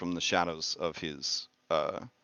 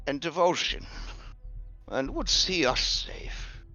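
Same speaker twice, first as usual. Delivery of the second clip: raised, solemn prophetess; seer woman voice; 8 semitones higher than usual. Acted. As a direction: speak prophecy, slow and grave. GM voicing Dula.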